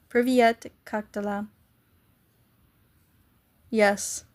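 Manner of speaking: ordinary talk, nothing marked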